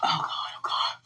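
hushed whispered plea. Real, not acted. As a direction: whisper it into the dark. Whispered prayer alone.

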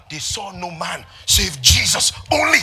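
loud, high, fast shouted preaching; full-force preaching. Acted. Preacher shouting to the church about law and grace. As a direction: drive the point home, shouting.

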